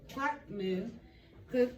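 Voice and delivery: ordinary talk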